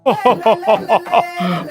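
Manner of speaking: rolling "ho ho ho"; jolly Santa laugh. Acted. Santa enters kids programme, hearty laugh.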